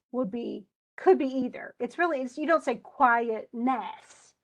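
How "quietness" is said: quietness slowly, with NESS punched at the end; wrong stress on NESS. Shown as a demonstration. Teacher showing a too wacky reading, quietness stressed on NESS. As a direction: overdo the last syllable of quietness. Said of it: "That's a little too wacky".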